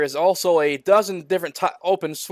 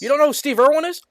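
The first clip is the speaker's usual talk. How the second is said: surprised; 10 semitones higher, 3 dB louder, faster than usual. Real, not acted. Disbelief at not knowing.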